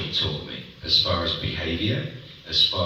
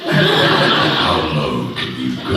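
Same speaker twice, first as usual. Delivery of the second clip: deep slow voice; 7 semitones lower, 6 dB louder, much slower than usual. Retold or imitated. Mimicking Bob competing for the lowest voice. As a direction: lower and slow, booming. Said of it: "You just see Bob go".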